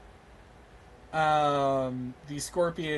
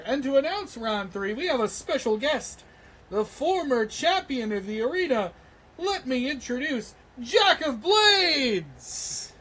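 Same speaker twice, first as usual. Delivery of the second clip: arena announcer; 8 semitones higher than usual. Acted. Game master introduces the former champion as arena announcer. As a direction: build to a big, stretched-out name reveal. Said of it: "you hear the announcer".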